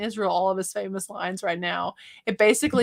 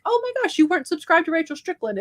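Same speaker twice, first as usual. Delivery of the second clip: high, quick put-on gasp of disbelief; mock shock; 8 semitones higher, 3 dB louder, faster than usual. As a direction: act scandalized at a stranger, playfully. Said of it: "and be like".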